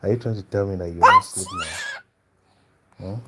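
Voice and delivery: put-on father scolding toddler at bedtime; mock stern scold. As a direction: raise your voice at the child who won't sleep.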